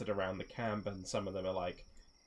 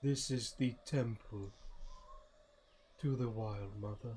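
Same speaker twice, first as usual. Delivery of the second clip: slow, measured character voice; solemn guide figure; slower than usual. Acted. Guide shows Firion the temple, slow solemn voice. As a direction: announce it slowly, with long pauses between phrases.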